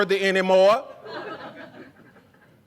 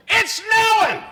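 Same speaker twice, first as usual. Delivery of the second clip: squealed high and loud, hammed-up; a high shout of mock delight; mock excited shriek; 3 dB louder, slower than usual. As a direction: shriek it like a thrilled social media poster. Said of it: "take photos outside of their window and go".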